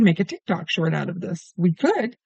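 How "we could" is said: musing agreement. Real, not acted. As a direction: try the idea on for size, lightly.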